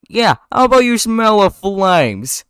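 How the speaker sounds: loud, high, drawn-out threat; hammed-up villainous threat; menacing threat. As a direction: taunt them with slow, loud menace. Ken threatens Barry in script.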